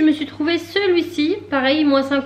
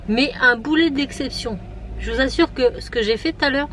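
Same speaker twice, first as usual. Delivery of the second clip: ordinary delivery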